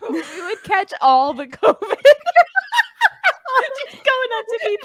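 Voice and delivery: high, laughing throughout. Real, not acted. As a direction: squeeze words through giggles. Laughing fit.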